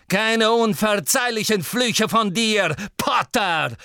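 loud, high mocking call. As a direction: shout over roaring flames. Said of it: "rief er durch das Tosen der Flammen". (laut über die Flammen rufen)

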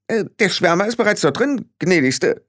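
high-pitched small voice; deferential little fairy man. Acted. Billy tells Frau Grad the creature is inside, deferential. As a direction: put it respectfully in a high voice.

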